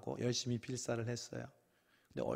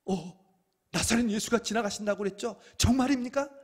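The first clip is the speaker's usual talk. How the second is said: higher, louder excited question; eager disbelief; 10 semitones higher, 8 dB louder than usual. Acted. Bartimaeus asking the crowd if Jesus is really passing, excited. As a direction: grab at the news.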